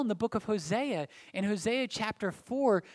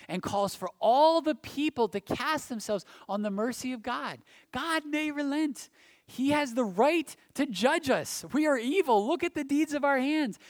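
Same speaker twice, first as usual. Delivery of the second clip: impassioned preaching; 8 semitones higher, 5 dB louder than usual. Acted. Preacher urging the congregation to hope for mercy, impassioned. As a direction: build it into a fervent appeal for mercy.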